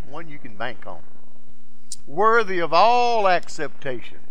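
loud, driving sermon voice; full-force preaching. Acted. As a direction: preach it hard to the congregation.

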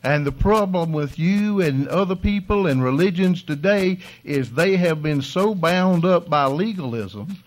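loud, high, driving sermon; full-force preaching. Real, not acted. Pastor preaching freedom to his congregation at full force.

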